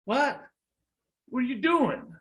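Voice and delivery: shocked, high-pitched cry at normal volume. Acted. Bitten boy cries out to the snake in shock. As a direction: let the pitch leap up in startled disbelief. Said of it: "That boy cries out".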